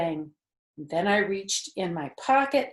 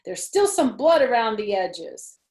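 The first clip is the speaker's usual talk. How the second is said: loud announcement; 4 semitones higher, 8 dB louder than usual. A narrator's reading. Continues the shouted news.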